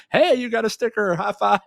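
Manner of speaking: high, loud celebratory cheer; making a big deal. Retold or imitated. Parents rewarding each other in front of daughter, making a big deal. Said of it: "making a big deal of it".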